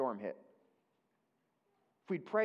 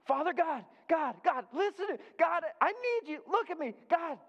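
much higher and louder desperate calling; crying out to God; 12 semitones higher, 4 dB louder than usual. Retold or imitated. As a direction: cry out to God for attention. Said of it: "he's crying out".

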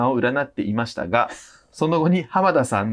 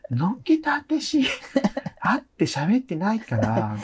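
campy voice; 7 semitones higher, slower than usual. Pretend.

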